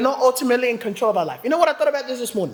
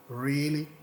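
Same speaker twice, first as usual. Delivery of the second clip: doubtful wife; 8 semitones lower, 8 dB quieter than usual. Retold or imitated. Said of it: "She goes".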